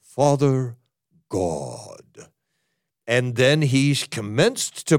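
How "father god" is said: Father drawn out, God dropped low and deep; exaggerated holy-man voice; full venerable prayer voice. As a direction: sink into a grave, pious intoning. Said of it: "The way he did it was more like this".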